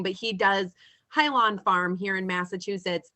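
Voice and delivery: ordinary talk, nothing marked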